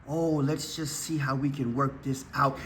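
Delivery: quiet mock of timid good people. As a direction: sneer it gently like a pushover. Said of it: "the strategy is by the good people".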